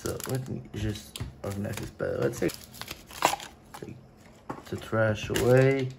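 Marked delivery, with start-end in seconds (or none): none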